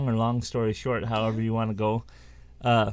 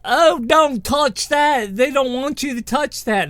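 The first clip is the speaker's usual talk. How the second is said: urgent warning; 13 semitones higher, 7 dB louder than usual. Retold or imitated. Girl warns nanny off the doll.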